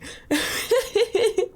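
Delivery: squealing laugh; high laugh. Real, not acted. Laughing at chat redeems.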